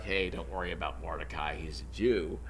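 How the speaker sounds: low, quiet mock reassurance; sarcastic. The wife and friends mockingly reassure Haman, quietly sarcastic. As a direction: toss it off with a dry, sarcastic shrug. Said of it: "rather sarcastically".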